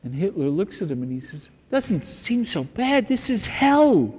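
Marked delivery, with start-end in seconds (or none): 1.7-4.1 high, slow, louder bitter echo ending on hell; anguished retort. Retold or imitated. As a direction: throw his words back, drawn out and aggrieved. Hitler retorts in torment.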